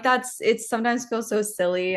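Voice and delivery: usual speaking voice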